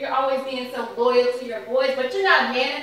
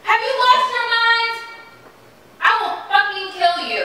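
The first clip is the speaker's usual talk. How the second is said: high, raised furious threat; furious outburst; 9 semitones higher than usual. Acted. Wife threatening husband after his insult, furious high yell. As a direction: explode at him, furious. Husband calls her bitch, she explodes.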